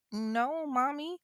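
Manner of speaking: put-on high little-kid voice; confused six-year-old. Six-year-old denying it to his mother, in a confused child voice. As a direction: imitate a thrown small child answering mom. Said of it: "again, he's six".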